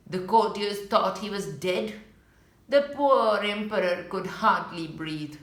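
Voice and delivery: plain reading voice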